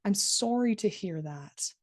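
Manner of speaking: genuine sympathy, leaning on "sorry"; stress on SORRY. Shown as a demonstration. Instructor showing students a genuine empathy phrase, stress on "sorry". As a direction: say it sincerely, stressing the word "sorry". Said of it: "you can hear that stress on sorry".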